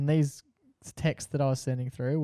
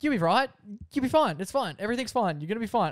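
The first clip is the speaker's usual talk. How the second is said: high, insistent string of comforting; retold shaky-nerved attempt to reassure; panicked reassurance; 5 semitones higher, faster than usual. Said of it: "I'm panicked. I'm shaking".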